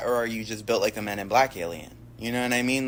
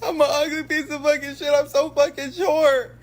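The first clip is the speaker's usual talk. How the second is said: mock self-pitying tall man. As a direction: shriek it like a tall man sobbing at his mirror. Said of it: "being like".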